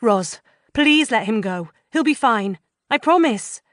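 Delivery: pleading. A narrator's reading. Narrator pleading with Roz.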